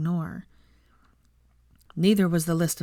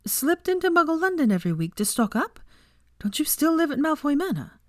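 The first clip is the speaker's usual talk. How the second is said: put-on a much higher young woman's voice, incredulous; higher-voiced heroine, mock disbelief; Hermione, teasing; 10 semitones higher than usual. A narrator's reading. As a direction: tease him in a high, disbelieving voice.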